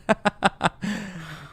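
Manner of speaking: high, drawn-out giggle; long amused laughing fit; helpless laugh. Real, not acted. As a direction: laugh at your own failed reach. Host fails to reach, laughs.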